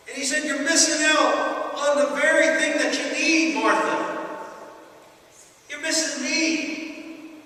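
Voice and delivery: Jesus pleading with busy Martha; urging Jesus. Retold or imitated. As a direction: urge her not to miss the one thing. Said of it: "the urging in his voice".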